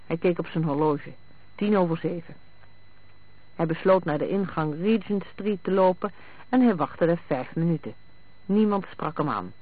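usual speaking voice

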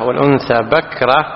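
usual speaking voice

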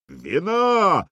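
high, loud roar; growling shout. Acted. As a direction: roar it like a viking chief. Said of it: "зарычал Хрольф".

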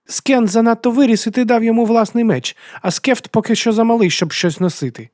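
put-on very high, loud child's voice; high-pitched scolding child; indignant little girl. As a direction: argue back as a shrill young daughter. Narrator voices the chieftain's daughter.

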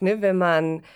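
usual speaking voice

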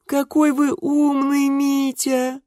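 very high, slow sisterly sing-song; sing-song cooing. A narrator's reading. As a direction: croon it high, half mocking, half admiring. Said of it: "пропели сестрички, то ли издевались".

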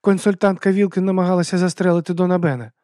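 high-pitched plaintive bot; childlike high bot reporting trouble. A narrator's reading. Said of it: "жалібно промовив Мікі".

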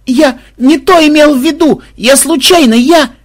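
very high, loud flustered excuse; panicked babbling. A narrator's reading. Robber pleading accident to Мелисса, panicked. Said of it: "успел пролопотать грабитель".

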